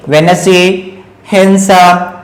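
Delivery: वेनेसी and हेंसा, with no d; silent D. Shown as a demonstration. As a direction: drop the d: "wen-es-ay, han-sum". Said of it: "ये क्या पढ़ेंगे".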